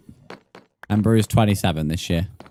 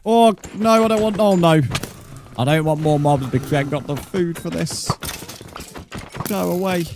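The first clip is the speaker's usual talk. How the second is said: much higher, whining no no; high panicked whine; 9 semitones higher than usual. Real, not acted. Dodging mobs without food, panicked. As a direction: yelp like he is cornered.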